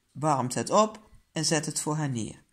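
ordinary delivery, nothing marked

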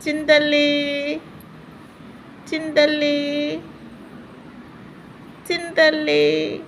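slow stretched pet name. Real, not acted.

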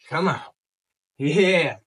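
usual speaking voice

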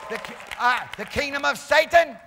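very loud, exultant revival shout; shouted preaching. Real, not acted. Preacher contrasting God's kingdom with Satan's, exultant shouting. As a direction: hammer each word, building to a roar of celebration.

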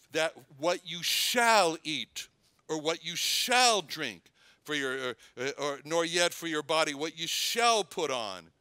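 "shall" stretched and punched in the verse; stress on SHALL. Shown as a demonstration. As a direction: lean hard on each "shall", drawn out. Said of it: "all these emphasis on the word shall".

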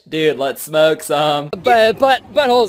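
lightly voiced stoner. Stoner voices, high and loud.

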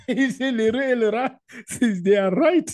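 excited, high and loud through laughter; laughing while telling. Real, not acted. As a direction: retell it cracking up with laughter. Laughing retelling of Saudi man. (raconté en éclatant de rire, voix haute et forte)

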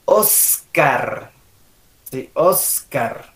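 "Oscar" with a long hissed S; slow, low model "Oscar"; emphasised S. Shown as a demonstration. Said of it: "la S".

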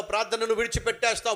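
normal talking voice

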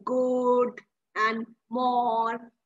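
put-on sweet drawl; affected drawl. Shown as a demonstration. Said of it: "dragging it is something which is affected".

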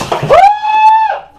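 high, loud scream from the cold; shocked scream. Real, not acted. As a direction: yelp at the icy water. Gets into the ice bath.

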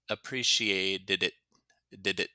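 "appreciated it" with the end tapped as "did it"; single out the bouncy "did it" in "appreciated it"; difficult linked sound. Shown as a demonstration. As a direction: demonstrate "appreciated it", then isolate "did it". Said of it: "difficult sound that will probably".

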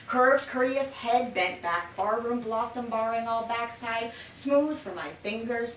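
spoken word climax, pushing hard; rising intensity. Acted. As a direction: build the heat with each phrase. Poem builds to climax.

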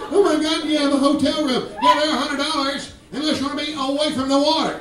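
high, urgent hotel exchange; hammed-up evacuee and clerk; mock panic. Hurricane evacuees ask for a room.

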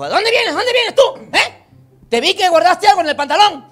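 voz mucho más aguda de mujer celosa; esposa celosa. Retold or imitated. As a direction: interroga al marido, desconfiada. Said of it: "y le dijo". (esposa celosa reclamando al marido)

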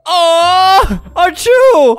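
fake sneeze.

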